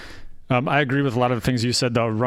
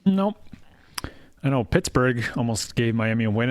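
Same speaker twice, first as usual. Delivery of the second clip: ordinary talk, nothing marked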